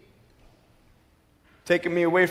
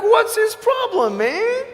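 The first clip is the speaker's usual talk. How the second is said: exasperated thought; 4 dB louder, slower than usual. Retold or imitated. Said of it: "And I'm like".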